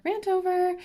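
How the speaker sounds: jumps way up high on "rant over"; chirpy high-pitched sign-off; high squeaky rant over. Real, not acted.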